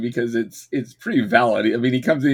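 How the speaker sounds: usual speaking voice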